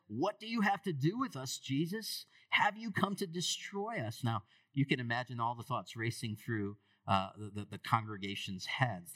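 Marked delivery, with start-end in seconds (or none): 0.1-4.2 higher, agitated possessed man's cry; a cornered demon lashing out at Jesus. Acted. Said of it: "He says".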